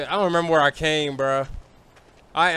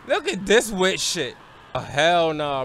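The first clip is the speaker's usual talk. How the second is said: ordinary delivery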